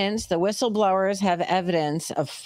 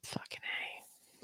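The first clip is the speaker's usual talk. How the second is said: whispered; 14 dB quieter, much slower than usual. Real, not acted. Muttered aside during banter.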